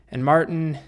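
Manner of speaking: normal talking voice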